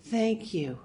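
lightly voiced little-girl breathlessness; breathless little girl. A narrator's reading. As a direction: answer a touch like a breathless child. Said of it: "Her voice was breathless, like a little girl's".

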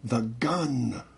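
the mother's voice heard in her head. A narrator's reading. Mother speaks to her as she nears the door, urgent warning. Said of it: "Mother said".

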